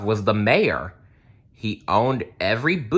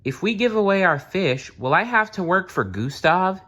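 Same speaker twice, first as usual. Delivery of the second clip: put-on much higher, small boy's worried question; young boy asking; 11 semitones higher than usual. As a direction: ask like a little boy afraid of the future. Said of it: "Nicholas asked his father".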